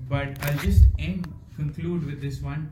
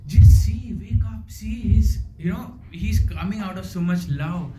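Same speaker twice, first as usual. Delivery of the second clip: urgent plea to brother; 6 semitones higher, 5 dB louder than usual. Retold or imitated. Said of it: "He says".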